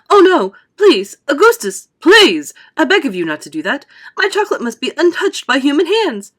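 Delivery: loud, high pleading cry. A narrator's reading. Wonka begs a boy to leave his chocolate alone, crying out. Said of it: "he cried out".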